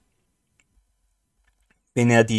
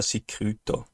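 "Secreto" stressed on the middle syllable, said as ü; 5 dB quieter than usual. Shown as a demonstration. Teacher explaining the chosen stress, "Secreto" stressed mid-word. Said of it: "gerade in einer unbetonten Silbe".

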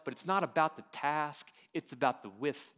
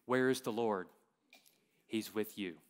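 ordinary talk

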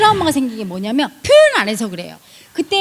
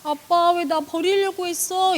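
crying demo; 6 semitones higher, slower than usual. Shown as a demonstration. Healer demos crying out to father, mock tearful. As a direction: show how to cry it out. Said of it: "이렇게 울어냅니다".

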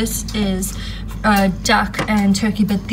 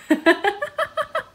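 high giggle; 11 semitones higher than usual. Real, not acted. Laughing at the sock pig.

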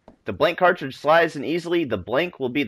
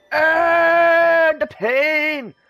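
much higher, louder, stretched wail; drawn-out cry of pain; 14 semitones higher, 6 dB louder, much slower than usual. Acted. Masked man suffering as the mask goes on, stretched wail. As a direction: howl the pain out slowly. Voicing character in agony.

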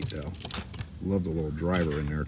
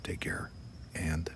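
hushed late-night voice; whispering; 7 dB quieter than usual. Real, not acted. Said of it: "Sorry about the whisper".

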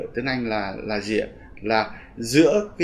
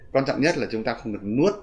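ordinary delivery

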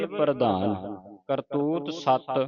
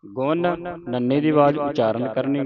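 ordinary talk

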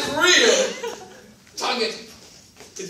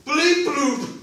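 robot voice; 3 semitones higher than usual. Pretend. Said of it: "I am a robot".

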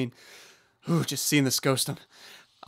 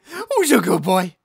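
higher squeaky pet talk; squeaky praising a dog; 3 semitones higher, 7 dB louder than usual. Acted. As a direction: gush over the dog.

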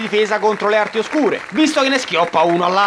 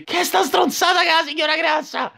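high-pitched comic indignation; exasperated rant; 8 semitones higher than usual. Acted. Critic fed up with the Fat Lady subplot, exasperated. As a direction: protest in high-voiced disbelief.

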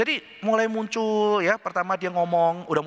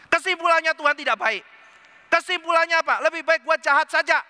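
high, loud bitter declaration; bitter outburst; 10 semitones higher, 5 dB louder than usual. Retold or imitated. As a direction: raise it up and loud in defiance. Voicing Asaf's bitter conclusion. (ledakan pahit keras dan tinggi)

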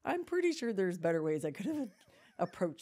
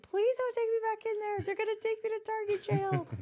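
very high, loud mock pleading; mimicked panicked child; 6 dB louder than usual. Toddler begging mom about store jail, high panicked wail. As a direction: squeal it like a hysterical toddler.

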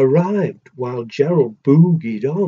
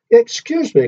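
polite cricket; 7 semitones higher than usual. Acted. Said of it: "coughed a cricket".

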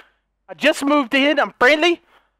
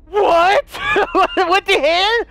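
shocked laugh; 7 semitones higher, much slower than usual. Real, not acted. Gamer laughing in shock at a prank.

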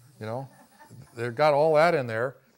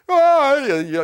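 Italian family yelling; 13 semitones higher, 13 dB louder, slower than usual. Retold or imitated. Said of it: "yell and scream".

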